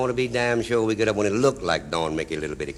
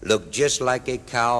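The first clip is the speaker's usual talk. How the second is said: ordinary talk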